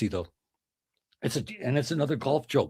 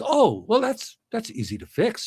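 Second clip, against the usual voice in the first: cheerful wife; 10 semitones higher than usual. Acted. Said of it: "And his wife says".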